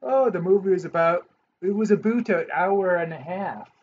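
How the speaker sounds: light Canadian impression with aboot; a hint of Canadian, aboot for about; mock Canadian accent. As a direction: playfully put on a Canadian accent. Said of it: "You know, they say "aboot".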